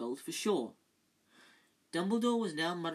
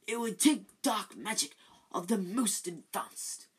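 grave Dumbledore; 5 semitones higher, 4 dB louder than usual. Acted.

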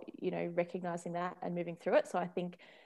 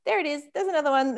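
higher, louder here-we-go-again exclaim; wry excited spotting; 8 semitones higher, 8 dB louder, faster than usual. Retold or imitated. Parent noticing yet another trigger from her kid, wryly excited. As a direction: point it out like catching it again.

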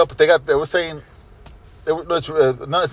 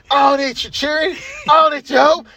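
arrogant heel voice; 10 semitones higher than usual. Retold or imitated. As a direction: puff up like a smug wrestler.

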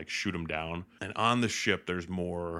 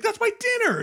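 high, loud mock-outraged yell; outraged protest; 9 dB louder than usual. Luke to the creature eating his food, outraged yell. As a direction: yelp in protest at a food thief.

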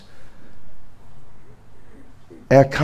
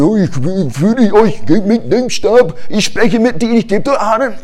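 mocking God's promise; 7 semitones higher than usual. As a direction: parrot the promise back sarcastically. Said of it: "Du hast versprochen".

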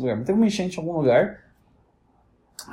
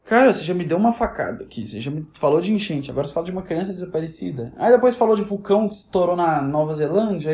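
normal talking voice

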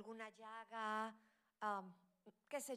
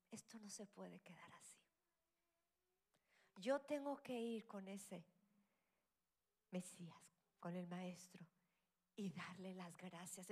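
whispered thought; 4 semitones lower than usual. Retold or imitated. Healed leper deciding to thank Jesus, hushed inner voice. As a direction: murmur it to yourself, resolved.

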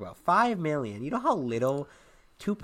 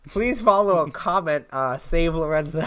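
louder, pushing the plea; 3 semitones higher, 6 dB louder than usual. Real, not acted. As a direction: call it out over him.

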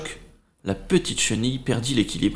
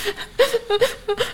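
high giggle. Acted. After caterpillar sobbing.

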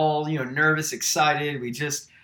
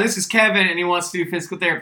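higher, louder, fast and sure; with confidence; 5 semitones higher, 5 dB louder, faster than usual. Retold or imitated. Retelling the classmate's confident wrong introduction, quick and sure. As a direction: rattle it off with full confidence. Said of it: "Like, with confidence, said".

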